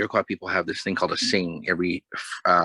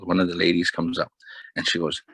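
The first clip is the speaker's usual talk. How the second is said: ordinary talk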